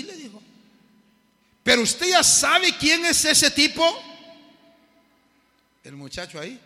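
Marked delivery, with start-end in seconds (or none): 1.6-3.9 a hint of a loud, scornful street youth; mock his sneer, a little higher and louder; scornful young man. Street youth sneering at the radio preacher, lightly voiced.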